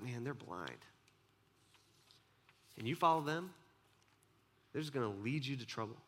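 soft warning. Retold or imitated. Jesus quietly warning disciples about blind leaders. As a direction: drop to a hush and warn them.

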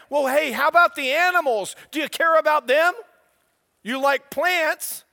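rising fervor, nearly shouting at the congregation; preaching at full force. Acted. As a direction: drive it home like a preacher at his peak. Sermon climax rising to shouting.

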